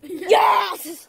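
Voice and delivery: triumphant high cheer; excited yes. Real, not acted. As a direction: cheer the win. Winning the pick.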